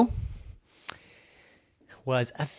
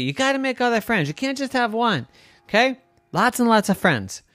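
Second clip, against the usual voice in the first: high, fast motherly lecture; nagging mom; 8 semitones higher, faster than usual. Retold or imitated. Evan quoting mom's advice on friends, nagging mom voice. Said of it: "That's what my mom says".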